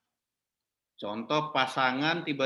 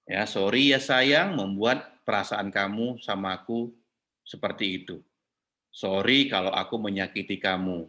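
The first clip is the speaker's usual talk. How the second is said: sincere apology; 7 semitones lower than usual. Shown as a demonstration. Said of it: "Suaminya ngomong".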